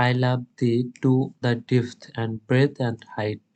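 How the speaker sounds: slow, measured reading of the sonnet, every syllable drawn out; slow poem recital. Acted. Teacher reading the sonnet aloud to students for scansion, slow measured recital. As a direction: recite it slowly so each beat can be counted.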